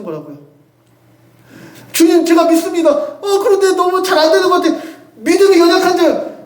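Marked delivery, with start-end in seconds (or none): 1.9-6.3 higher, laughing plea rising to a shout; pleading cry. Retold or imitated. Preacher voicing a struggling believer's prayer, plea building to a shout. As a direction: cry out for help with faith. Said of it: "했던".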